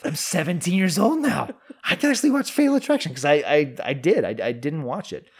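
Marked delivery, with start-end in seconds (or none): 0.0-3.1 high, rising squeal of glee; mock teenage excitement. Seventeen-year-old allowed to watch at last, excited high voice. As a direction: play a giddy teen, voice climbing very high. Acting out his thrilled teenage self.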